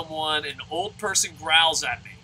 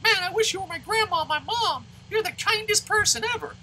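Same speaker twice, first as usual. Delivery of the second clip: very high, squeaky-sweet gush; mock adoration pitched way up; gushing admirer. Player imitating people gushing at a kind old lady, high-pitched gushing. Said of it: "one lady that you're like".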